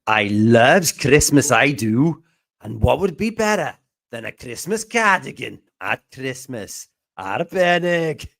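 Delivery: exaggerated Welsh accent, stretched out for show; hammed-up Welsh accent. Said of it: "That accent was a hate crime".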